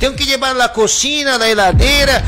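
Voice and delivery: voz alta y aguda, exigiendo con furia; grito enfadado. Retold or imitated. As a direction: reclama sus cosas gritando, cada vez más agudo. Hombre exige sus cosas, amenaza. (el hombre le grita que todo es suyo, furioso)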